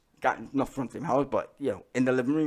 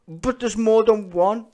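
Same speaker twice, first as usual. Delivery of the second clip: teacher; 9 semitones higher, 10 dB louder, slower than usual. Retold or imitated. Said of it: "And then it's like".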